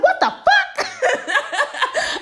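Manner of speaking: very high, slow drawn-out shriek with a laugh; stunned shriek. Retold or imitated. Girl hearing [name] beat [name], drawn-out high shriek. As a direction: stretch it out in high disbelief. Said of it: "I was like".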